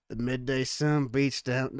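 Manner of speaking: usual speaking voice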